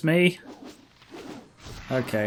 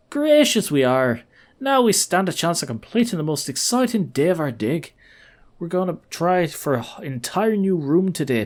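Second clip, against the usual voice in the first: grateful, excited archaeologist; 3 semitones higher than usual. Acted. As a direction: share your pride in the dig. Archaeologist thanks the party.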